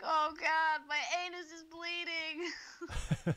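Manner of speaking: high, slow horrified wail; hammed-up dread; mock panic. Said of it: "It's just like".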